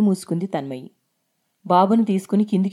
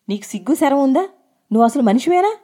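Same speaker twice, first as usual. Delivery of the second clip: higher, louder, indignant outburst; loud angry cry; 6 semitones higher, 4 dB louder, faster than usual. A narrator's reading. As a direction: yell it as an outraged accusation. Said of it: "గట్టిగా అరిచింది". (గట్టిగా అరుస్తూ నిలదీయడం)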